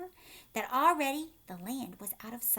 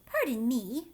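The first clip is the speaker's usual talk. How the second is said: usual speaking voice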